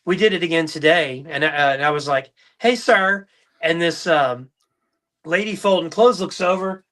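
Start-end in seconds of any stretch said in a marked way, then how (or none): 2.6-3.3 high, loud put-on woman's call; lady's voice. Dad pranking the drive-through in a lady's voice. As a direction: holler it like a lady somewhere unseen. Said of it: "this whistle sound".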